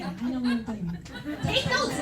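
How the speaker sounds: ordinary talk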